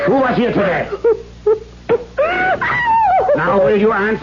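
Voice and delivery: high crying shout; shouted demand. Acted. Father threatening Katrine with the stick, shouting. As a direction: roar the question at her.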